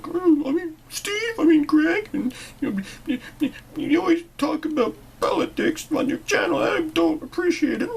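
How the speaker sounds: very high, loud whiny complainer; mock an upset viewer, squeaky and petulant; whiny viewer impression. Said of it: "people get all upset and like".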